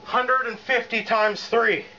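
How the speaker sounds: elated triumph. Real, not acted. Celebrating a lift success.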